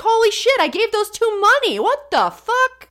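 [name] shocked. Retold or imitated. [name] realizing she gave them money, high shocked squeal. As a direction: squeal like a cheated donor. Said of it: "I bet [name] was like".

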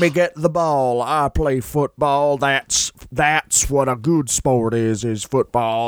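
slow, put-on boastful Fritz voice; Fritz impression. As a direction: drag out the words like a pompous Fritz. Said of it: "Well, Fritz said".

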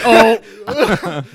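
surprised. Pretend. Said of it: "you're like".

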